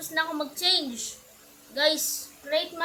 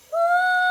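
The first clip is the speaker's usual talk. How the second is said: loud whoop; 10 dB louder than usual. Pretend. Scary cry while fleeing.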